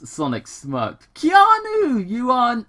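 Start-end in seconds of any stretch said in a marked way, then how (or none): 1.1-2.6 high, loud adoring gush; gushing fan. Acted. Sonic gushing at Keanu on screen, high and loud. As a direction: swoon over your hero.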